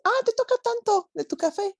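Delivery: imitating the stingy date. Mocking the date who asks her to pay, high and loud. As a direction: mimic him splitting the bill, pitched high. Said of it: "y me dice".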